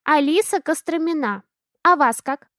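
put-on a bold little girl asking back; child introducing herself. A narrator's reading. Daughter asks the man's name, childlike.

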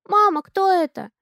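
put-on high little-girl voice, curious; a small child tugging at mom's jacket; little girl asking. A narrator's reading. Daughter asks mom about the stranger, childlike. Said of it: "спрашивает Алиса".

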